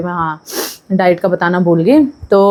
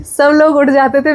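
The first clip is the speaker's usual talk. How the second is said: playful emphatic repeat; amused emphasis; 6 semitones higher than usual. Retold or imitated.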